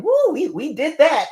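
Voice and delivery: high-pitched, drawn-out mock cheer; imitating self-congratulating revelers; mock celebration. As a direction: whoop it up like a premature victory party. Mocks people celebrating a win early.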